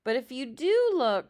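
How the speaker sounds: a sister crying out advice to her brother. Acted. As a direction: call the warning up at him. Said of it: "cried Annabelle".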